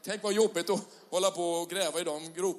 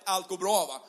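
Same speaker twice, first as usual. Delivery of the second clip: ordinary talk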